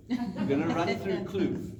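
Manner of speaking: high, laughing KZN-accent "Kloof". Shown as a demonstration. Coach laughs while demoing the Durban accent. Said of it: "get the accent right".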